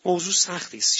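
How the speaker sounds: ordinary talk, nothing marked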